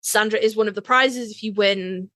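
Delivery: ordinary delivery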